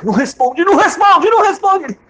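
rising high and loud repeated plea; urgent pleading. Retold or imitated. Husband urging the humiliated man not to reply. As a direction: keep begging him not to answer.

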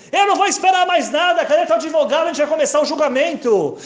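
high, loud impatient shout; a furious judge yelling about the missing lawyer; angry judge yelling. Retold or imitated. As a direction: yell at the courtroom like a fed-up judge. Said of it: "Ele tava gritando".

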